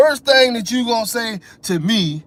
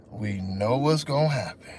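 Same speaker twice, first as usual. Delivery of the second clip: low, soft, doom-laden; deep ominous voice; 10 semitones lower, 7 dB quieter than usual. Retold or imitated. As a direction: say it like a grim undertaker. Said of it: "repeated with different voices".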